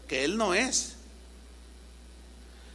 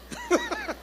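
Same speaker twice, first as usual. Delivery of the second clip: high burst of laughter; short laugh; 4 dB quieter than usual. Real, not acted. Pastor laughing at his own joke, short high laugh.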